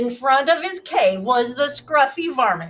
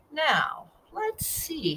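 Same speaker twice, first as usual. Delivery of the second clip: pondering; 4 semitones higher, 7 dB quieter, much slower than usual. Acted. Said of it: "he pondered".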